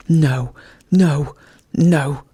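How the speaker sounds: loud raging no, no, no; raging refusal. A narrator's reading. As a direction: rage at him in refusal. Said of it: "the dog raged".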